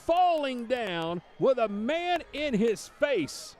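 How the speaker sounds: shouting, high and loud; excited touchdown call. Real, not acted. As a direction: yell the big play call. Touchdown call, unbelievable catch.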